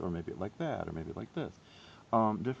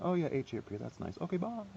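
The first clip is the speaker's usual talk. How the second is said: mock oblivious; 8 semitones higher, 4 dB quieter than usual. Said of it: "seeing H A R P and saying".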